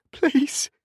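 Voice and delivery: very high, desperate plea; begging. A narrator's reading. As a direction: beg tearfully for mercy. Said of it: "she begged".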